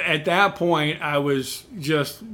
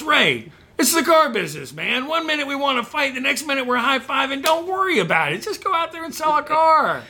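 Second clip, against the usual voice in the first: higher, cheerful boss voice laughing it off; upbeat manager; 9 semitones higher than usual. Retold or imitated. Manager cheerfully telling angry salesman to go sell. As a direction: brush it off like a jovial boss.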